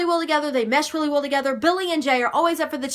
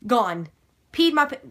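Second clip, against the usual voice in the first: ordinary talk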